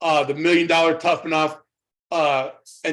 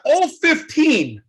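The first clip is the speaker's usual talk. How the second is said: excited disbelief; 10 semitones higher, much slower than usual. Real, not acted. Laughing at the absurd memory.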